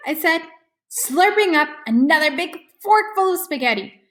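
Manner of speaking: ordinary delivery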